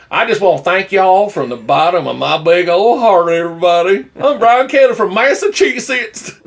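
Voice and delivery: high, hammed-up fake Southern accent; Brian Kelly. Podcaster mocking a Massachusetts coach thanking fans, fake Southern accent. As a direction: lay the phony Southern twang on thick. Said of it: "This fake Southern accent".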